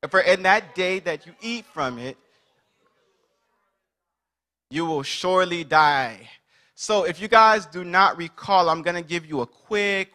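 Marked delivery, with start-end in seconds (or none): 0.1-6.3 lightly voiced God giving a warning; measured scripture read, slowing at the end. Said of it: "we're still reading in God voice".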